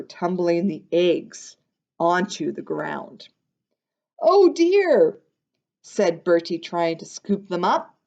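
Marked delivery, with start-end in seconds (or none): 4.2-5.2 high, loud little oh dear; dismayed. Acted. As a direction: cry out in dismay at the eggs. Bertie dismayed at broken eggs.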